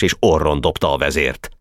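plain reading voice

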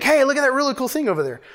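quick, high-pitched casual exclamation; casual excitement. Acted. Joking about how we talk today, casually excited. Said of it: "Can you imagine if we talked like that?".

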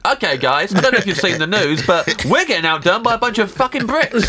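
mock alarmed meeting announcement, pitch climbing high; chip-maker announcement. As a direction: break bad news to the team, rising in outrage. Acted morning meeting of rival chip makers.

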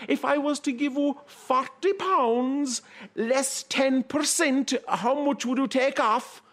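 high, polite, bashful farmer voice; timid country man. Acted. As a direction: ask shyly in a high voice. Tygeen asking the girl timidly.

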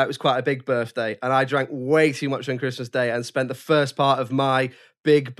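ordinary delivery

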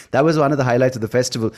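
usual speaking voice

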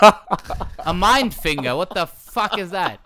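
slow, loud, laughing through it; laughing disbelief. Real, not acted. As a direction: drag it out, cracking up. Mocking the mind finger slip.

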